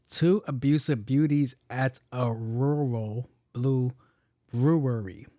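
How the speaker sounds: slow, word-by-word demonstration; careful tongue twister. Shown as a demonstration. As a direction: spell the twister out slowly with gaps. Shows the sentence for others to try.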